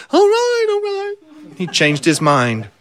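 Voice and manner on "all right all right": high, panicked yelp; caving in under threat; scared miller squeaking yes; frightened giving in. A narrator's reading.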